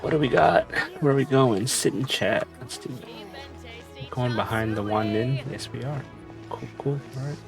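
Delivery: ordinary delivery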